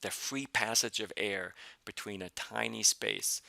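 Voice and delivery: ordinary delivery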